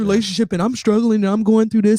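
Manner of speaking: whiny imitation of a struggling woman; mildly mocking lament; mock complaining woman. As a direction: mimic the self-pitying talk. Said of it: "Women who walk around".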